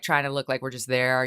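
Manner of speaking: ordinary talk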